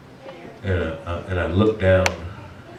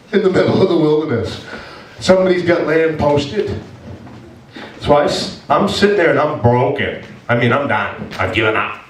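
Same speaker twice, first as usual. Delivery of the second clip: higher, louder tearful voice; crying; 6 semitones higher, 4 dB louder than usual. Real, not acted. Confessing to listeners he broke down, higher and tearful. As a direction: push the words out close to tears. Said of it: "I'm crying now. For real".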